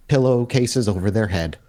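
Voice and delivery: ordinary delivery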